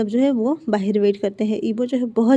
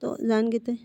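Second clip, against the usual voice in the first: ordinary delivery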